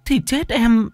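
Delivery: very high, hesitant servant voice; flustered maid. A narrator's reading. Maid begging her mistress not to go out, flustered and high. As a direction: plead high and flustered, stumbling at the start. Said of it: "Con Mận lúng túng".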